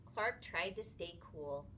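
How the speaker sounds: usual speaking voice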